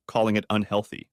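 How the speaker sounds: ordinary delivery, nothing marked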